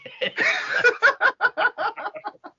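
long high-pitched laugh; high helpless laughter. Real, not acted. Co-host finds friend's rant ridiculous, high laughter. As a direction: burst out in a long, high cackle.

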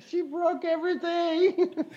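slow, high and tearful, each word of She broke everything drawn out; retold crying. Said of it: "I was crying".

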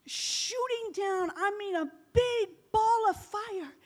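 ordinary talk, nothing marked

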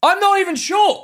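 high-pitched defensive protest. Real, not acted. Friend teased about short men, defensive protest. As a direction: protest as if personally insulted. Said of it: "Now you get defensive".

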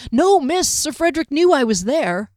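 defensive guard. A narrator's reading. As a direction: hurry to deny it.

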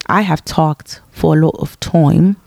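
put-on British accent. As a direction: say it in a playful mock British accent. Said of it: "when I talk in a British accent".

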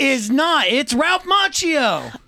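high, loud heated argument; fired up and insistent; heated rant. Real, not acted.

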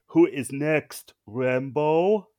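loud, slow Schwarzenegger quip; Arnie quip. Retold or imitated. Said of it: "he quips".

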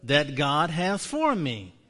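a slow call-and-response confession; slow proclaimed confession. Shown as a demonstration. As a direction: proclaim it slowly for the room to repeat. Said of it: "Say this one with me".

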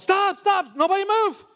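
high, loud call to freeze everyone; frantic shout. Retold or imitated. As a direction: yell stop to the whole pool. Shouting at friends in pool.

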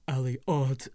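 a mild mocking imitation of Jamie, sneering; imitating Jamie. Girl mimicking Jamie's sneering nickname to the goat. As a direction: copy his sneer, lightly. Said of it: "the tone in his voice".

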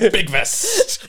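laughing. Real, not acted. Group laughing at joke.

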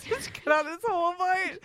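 talking through high laughter; laughing. Real, not acted. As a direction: laugh while you say it. Laughing while speaking.

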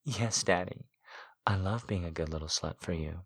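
quiet and soft; soft quiet reply. A narrator's reading. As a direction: answer softly. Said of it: "I answered".

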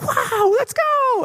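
very high, thrilled wow; kids cheering. Retold or imitated. Kids cheering a friend's testimony, high and thrilled. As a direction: squeal it like excited kids.

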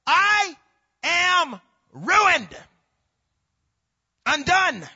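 high, loud cries with gaps between; slow, broken-up shouted cry. Acted. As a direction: shout each word as a lament. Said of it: "I cried".

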